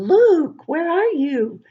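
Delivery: high, loud call across the room. Acted. Dan calling for Luke in the empty room, high loud call. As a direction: call for the missing man. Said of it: "calls Dan".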